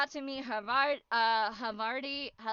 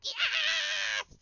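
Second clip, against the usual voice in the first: much higher delighted cry; triumphant yes. Retold or imitated. Vlogger answering the cheering staff with a high delighted yes. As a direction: crow with delight.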